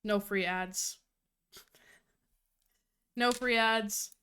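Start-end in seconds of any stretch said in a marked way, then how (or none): none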